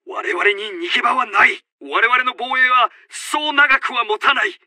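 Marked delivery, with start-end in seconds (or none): none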